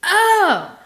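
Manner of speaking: a high, loud startled oh; sudden realization. Retold or imitated. Realizing at the checkout she forgot to pay, startled oh. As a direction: snap back to reality all at once. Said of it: "And then I go".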